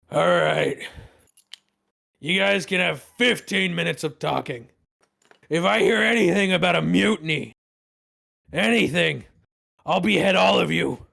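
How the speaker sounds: put-on drunk captain. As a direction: drunk captain warning prisoners against mutiny, loud and threatening. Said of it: "you could tell he's definitely drunk".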